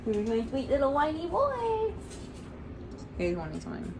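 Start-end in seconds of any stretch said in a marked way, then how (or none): 0.1-1.9 cooing pet talk. Real, not acted. Owner greeting a beloved whiny dog, cooing. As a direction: coo it upward to your dog.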